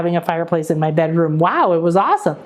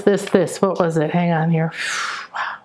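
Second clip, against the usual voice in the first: ordinary talk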